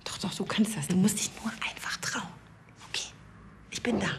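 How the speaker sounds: soft whispered encouragement; reassuring whisper. Real, not acted. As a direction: whisper close, to calm her nerves. Director coaching nervous actress.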